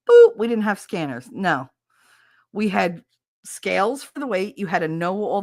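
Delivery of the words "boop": high loud boop; scanner beep. Shown as a demonstration. Describing old checkouts, a scanner boop. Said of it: "those little boop".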